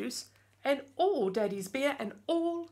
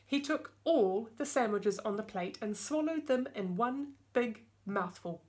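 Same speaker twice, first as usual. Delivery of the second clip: ordinary delivery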